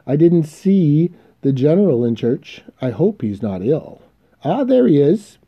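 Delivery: high, loud, effusive greetings; hammed-up society lady hailing acquaintances; gushing socialite. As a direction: call out merrily to the whole congregation. Voices Mrs Montgomery greeting churchgoers.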